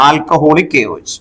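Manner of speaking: slow dictation. Shown as a demonstration. Teacher repeats reagent name.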